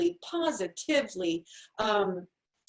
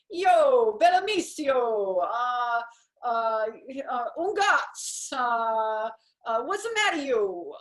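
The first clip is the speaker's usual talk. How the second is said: hammed-up fake Italian accent, louder; 2 semitones higher, 5 dB louder, much slower than usual. Shown as a demonstration. Mocking someone faking Italian without learning it, hammed-up Italian accent. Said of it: "maybe if I say some words that sound like they're Italian".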